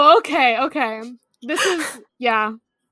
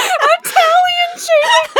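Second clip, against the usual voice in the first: much higher, slow, laughing incredulous question; amused disbelief; 4 dB louder, slower than usual. Real, not acted. To a friend's odd phrase, a high laughing disbelieving echo. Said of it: "I think that's just called racism".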